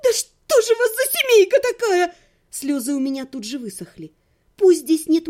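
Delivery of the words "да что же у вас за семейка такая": high, tearful indignation; exasperated outburst. Acted. Heroine protesting to the vengeful brother, high exasperated wail.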